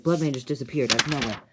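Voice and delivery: loud, urgent outburst; retold alarm. Halfling tells the others Bloodman vanished, loud mock alarm. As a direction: call it out as acted alarm. Halfling reports a sudden disappearance.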